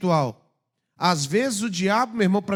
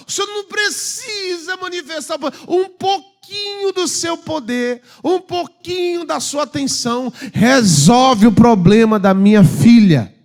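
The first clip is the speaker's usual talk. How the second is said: loud, high, slowing plea for a crumb of power; pleading woman; 7 semitones higher, 5 dB louder than usual. Retold or imitated. Mother begging Jesus for her daughter, loud high plea. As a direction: beg him like a desperate mother. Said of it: "Ela tá falando assim, ó".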